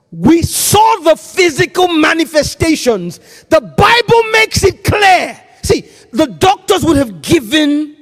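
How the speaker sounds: high, loud preaching cry; preaching shout. Real, not acted. Preacher proclaiming the Bible to his congregation, shouting. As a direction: preach it out at full force.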